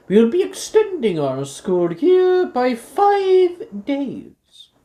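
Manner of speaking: loud, slow formal school announcement; headmistress announcement. Acted. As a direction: proclaim the school events grandly.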